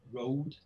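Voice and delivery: ROAD with a gliding diphthong vowel, "roh-ood"; received pronunciation. Shown as a demonstration. Linguist demonstrating the English standard vowel, ROAD in received pronunciation. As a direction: say ROAD the RP way, two vowel sounds together. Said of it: "this vowel sound in received pronunciation".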